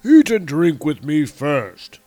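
slow, loud giant voice; giant alien. A narrator's reading. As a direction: rumble slowly like a giant. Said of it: "said Skrymir".

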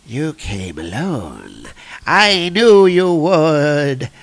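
very slow, drawn-out villain; God Butcher. Acted. Villain greeting Thor from his cave, slow menace.

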